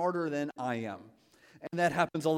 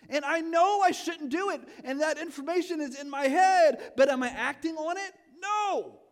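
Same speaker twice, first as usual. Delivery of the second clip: rising, louder and higher to a shouted no; preaching shout; 11 semitones higher, 6 dB louder than usual. Real, not acted. Pastor confessing his phone habit to the congregation, rising to a shout. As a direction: build the confession up to an emphatic cry.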